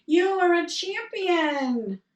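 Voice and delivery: high, loud, slow call of "You are a champion"; father shouting praise. Retold or imitated. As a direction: proclaim it to the mountains. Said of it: "father said".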